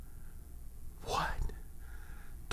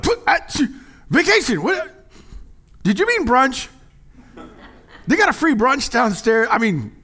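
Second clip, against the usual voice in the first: much higher, louder, exaggerated whiny protest; mock whine; 12 semitones higher, 6 dB louder than usual. Pastor playing a lazy pastor dodging church, whiny squeal. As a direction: squeal the complaint like a spoiled kid.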